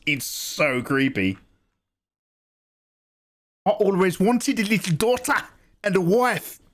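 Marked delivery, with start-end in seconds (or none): 3.7-6.6 put-on South African accent. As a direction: play the creepy sleeper agent in a South African accent. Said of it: "That's perfect South African".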